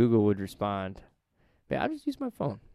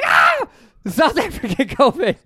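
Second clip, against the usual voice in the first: much higher, louder mock scream of alarm; mock panicked shout; 12 semitones higher, 6 dB louder than usual. Mocking panic over a new variant, hammed-up shout. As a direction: yell it like a horror reveal. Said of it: "And you're like".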